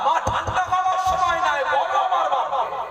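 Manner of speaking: high, forceful insistence; threatening demand. Acted. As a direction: demand it hotly. Son demanding answer. (চড়া গলায় হুমকি)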